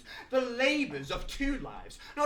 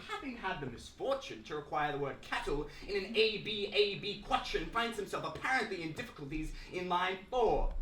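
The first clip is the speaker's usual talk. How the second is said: ordinary talk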